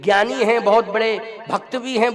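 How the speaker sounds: usual speaking voice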